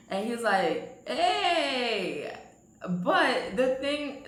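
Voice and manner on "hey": higher, gliding hey; imitated drawn-out greeting. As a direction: imitate his stretched-out greeting. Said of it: "he did one of those".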